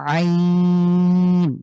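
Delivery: আইন squeezed from mid-throat, long and drawn out; long Arabic ain from the throat; throaty ain. Shown as a demonstration. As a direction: press the throat and hold আইন. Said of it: "কণ্ঠে চাপ দিয়ে গলার মাঝখান থেকে".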